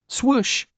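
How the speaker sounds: high whooshing swoosh; swoosh sound. A narrator's reading. Narrator voicing a pancake swoosh. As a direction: sell the flipping sound.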